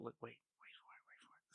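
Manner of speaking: hushed suspenseful whisper. Pretend. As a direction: whisper it like a nature documentary. Hushed narration of cohost's face.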